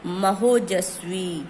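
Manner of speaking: महोजस्वी stretched out slowly and clearly; slow, clear teacher's answer; slow drawn-out answer. Shown as a demonstration. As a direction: draw out महोजस्वी so students hear the join. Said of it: "teacher draws out the joined word".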